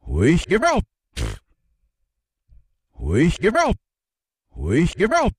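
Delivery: garbled reversed syllables; backwards speech. Shown as a demonstration. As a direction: mimic a reversed recording. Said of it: "Now reverse".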